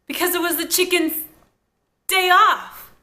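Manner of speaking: Because it was the chicken's, long pause, then day off, loud and high; random pause. Retold or imitated. Mimicking Caitlin's awkward pause in the punchline. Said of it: "critique Caitlin's delivery there".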